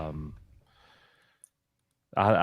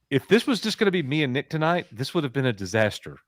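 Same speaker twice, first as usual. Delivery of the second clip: ordinary talk, nothing marked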